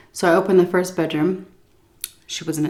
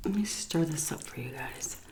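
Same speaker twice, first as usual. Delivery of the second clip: usual speaking voice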